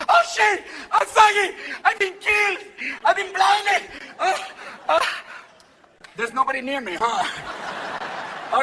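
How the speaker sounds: high, loud hammed-up agony; hammed-up flopping player; faking injured player. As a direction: fake a dramatic injury.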